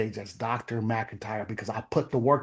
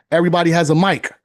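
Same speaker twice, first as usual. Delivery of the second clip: louder and faster, punching "mic" hard; emphatic repeat; 4 semitones higher, 9 dB louder, faster than usual. Real, not acted. Motivator driving his point home to veterans, loud and quick. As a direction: hammer "Everybody has a mic" home.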